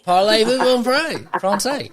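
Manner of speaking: high, slow mock-southern French; southern-accented French. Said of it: "with this southern accent".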